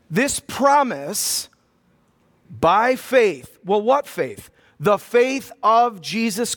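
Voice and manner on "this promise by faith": very slow, drawn out, with a long pause between phrases; read slowly. Shown as a demonstration. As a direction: stretch out every word and let it land. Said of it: "Let me read it again slow".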